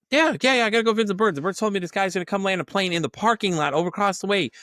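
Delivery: high, loud, eager insistence; eager hallucinating girl. Retold or imitated. The girl insists to the boss, high and eager. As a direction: gush about visiting the birds.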